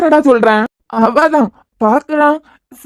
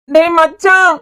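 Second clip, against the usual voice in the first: friend shouting into the phone for attention; 6 semitones higher, slower than usual. Acted. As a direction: yell for your buddy down the line. Calling friend loudly on phone.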